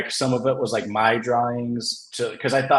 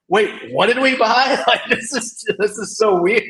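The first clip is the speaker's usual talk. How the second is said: high, baffled mock publisher; mock confused publishers; 10 semitones higher, 4 dB louder than usual. Mimicking publishers baffled by their own purchase, high and mock-confused. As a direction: squeak it out like a puzzled exec.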